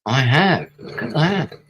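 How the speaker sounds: high, loud burst of delight; real gleeful exclamation; excited shout. Real, not acted. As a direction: yell with sudden joy. Surprise success in game.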